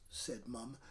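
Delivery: ordinary delivery, nothing marked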